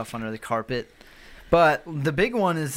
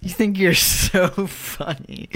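higher, slower mock indignation; mock offended; 6 semitones higher, 4 dB louder, slower than usual. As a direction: play at being insulted.

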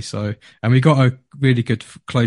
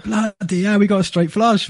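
much higher, thrilled disbelief; amazed excitement; 9 semitones higher than usual. Retold or imitated. To his mate over the poker table, thrilled exclamation. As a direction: blurt it out, can't believe the cards. Reacting to straight flush.

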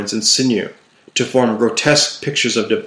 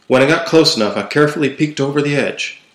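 plain reading voice

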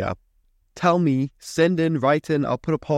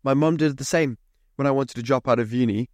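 usual speaking voice

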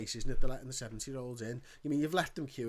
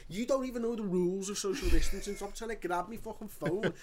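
retold angry telling-off; 7 semitones higher, 5 dB louder than usual. Pharmacist telling off security guard.

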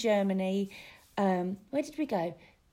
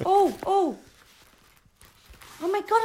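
ordinary talk, nothing marked

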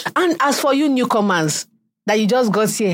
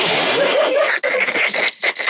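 high breathy mock giggle. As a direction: giggle like a coy humblebragger. Mocking humblebragging women.

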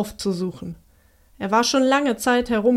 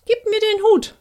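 sudden high, louder demand; eager youth asking for the hat, pitched up high; 10 semitones higher, 4 dB louder than usual. A narrator's reading. Young man to the giants, eager and high. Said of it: "sagte der Jüngling".

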